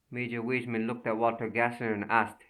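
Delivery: plain reading voice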